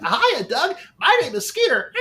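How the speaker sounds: high cartoon kid voice; a high nasal-free cartoon sidekick greeting; Skeeter from Doug. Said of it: "cartoon voices like Skeeter from the cartoon Doug".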